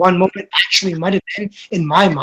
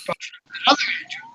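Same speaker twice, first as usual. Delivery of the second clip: breathy whisper, quicker, on other Andrew; whispered emphasis; 10 dB quieter, faster than usual. Shown as a demonstration. Whispering to his podcast friend which Andrew he means. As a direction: whisper it like a secret aside. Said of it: "not this Andrew".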